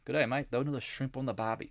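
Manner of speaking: playful mock Australian greeting; put-on Australian accent. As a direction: greet them like a cartoon Aussie. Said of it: "I love talking to Australians".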